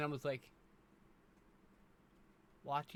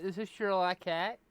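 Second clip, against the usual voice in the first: bright introduction; 5 semitones higher, 5 dB louder than usual. Shown as a demonstration. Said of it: "Say hi to chat".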